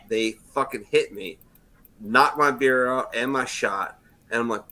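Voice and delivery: ordinary talk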